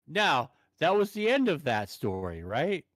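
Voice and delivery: ordinary talk, nothing marked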